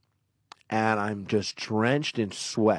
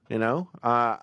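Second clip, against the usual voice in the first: ordinary delivery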